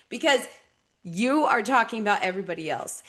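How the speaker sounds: usual speaking voice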